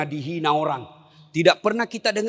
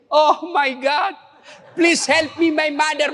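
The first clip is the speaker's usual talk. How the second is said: mock English prayer; 8 semitones higher, 3 dB louder, slower than usual. Preacher mocks English prayer.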